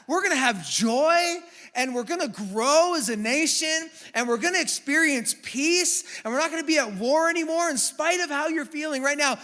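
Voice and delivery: ordinary talk, nothing marked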